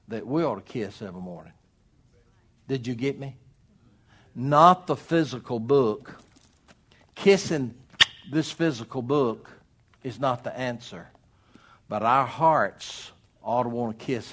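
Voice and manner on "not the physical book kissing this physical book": NOT and Kissing punched loud and high; emphatic preaching stress on NOT; stressed denial. Real, not acted. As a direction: hammer home that it's not the book.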